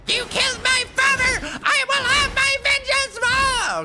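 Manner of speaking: 3: hammed-up avenging son shrieking at his father's killer; 1: mock anime hero cry. 4: mocking anime kid screaming for revenge, hammed-up falsetto.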